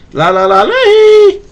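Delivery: loud high singsong calling out. Acted. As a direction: call the ducks home across the water. Master calling ducks home.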